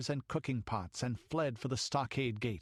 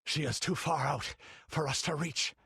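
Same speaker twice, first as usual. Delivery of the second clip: panting; 5 semitones higher than usual. Acted. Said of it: "panted Zerono".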